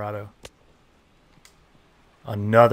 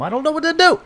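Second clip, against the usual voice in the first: jumps way up high and louder in a squeak; squeaky despair; 6 dB louder, faster than usual. Real, not acted. Frustrated gamer squeaks in despair at his hitting slump, high-pitched. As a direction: cry it out in a high desperate squeak. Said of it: "Why does he make me talk like that?".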